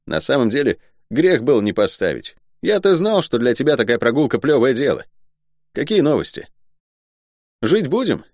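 high, lively soldier voice; excitable guardsman ribbing his friend; talkative guardsman, cheerfully griping. Acted. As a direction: chatter away in a high pitch. Guard Luk chatters to friend.

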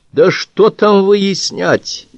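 high, drawn-out indignant question; indignant flare-up. A narrator's reading. Wounded Кривицкий reacts to the investigators' excuse, indignant flare-up. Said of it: "вскинулся Кривицкий".

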